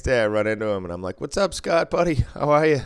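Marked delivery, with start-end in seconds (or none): 1.2-2.8 bright, higher hello to a buddy; cheery greeting. Retold or imitated. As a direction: greet him like an old friend, upbeat. Greeting Scott cheerfully next day.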